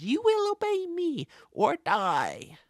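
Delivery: squeaky miniature villain, loud and slow; very high, drawn-out tiny tyrant threat; tiny Master. Acted. Host voicing the tiny Master's command, squeaky menace. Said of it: "reveals a tiny Master who says".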